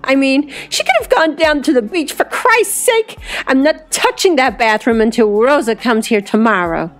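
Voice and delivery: high-pitched whine, settling slightly; exasperated complaint. A narrator's reading. Brianna refuses to touch the soiled bathroom, exasperated. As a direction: moan about it, pitch soaring midway. Drunk host complains in disgust.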